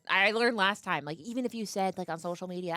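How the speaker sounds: usual speaking voice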